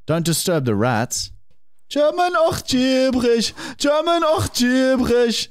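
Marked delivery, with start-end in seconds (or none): 1.9-5.5 high, hammed-up German jingle voice; mock German accent. Host chanting the game's title, mock German accent.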